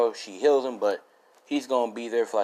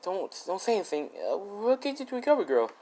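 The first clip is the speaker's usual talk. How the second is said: raised pitch, gently hushing; a girl's higher voice telling him not to talk; higher soothing girl; 10 semitones higher, 3 dB quieter than usual. Retold or imitated. Girlfriend hushing the hurt boy, higher and gentle.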